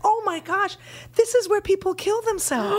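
chirpy high local woman, bluntly; cheerful local blurting a grim fact; high Australian local. Retold or imitated. Local woman's shocked warning.

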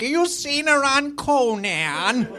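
high, slow grandma voice; exaggerated old grandmother, drawn out. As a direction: creak it out like a proud granny. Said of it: "like a grandmother".

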